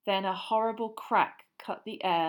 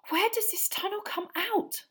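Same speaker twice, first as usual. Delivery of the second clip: breathless; 13 semitones higher than usual. A narrator's reading. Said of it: "Hermione asked breathlessly".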